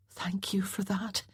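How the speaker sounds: soft unvoiced whisper. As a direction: barely breathe the thanks. Mother whispers thanks.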